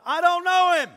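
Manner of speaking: high, explosive yell; shouted denial. Acted. Disciple denying Jesus to a stranger, angry shout. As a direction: shout it in indignant protest. Peter's second denial, acted louder.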